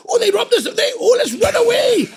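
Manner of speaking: high, loud fake fright; mock panic, wanting to run; mock scared. Imagined buyer, hammed-up scared and high. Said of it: "I expected this guy to go".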